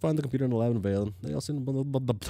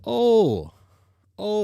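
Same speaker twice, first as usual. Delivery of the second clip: surprised oh; 12 semitones higher, 9 dB louder than usual. Real, not acted. Sudden realization.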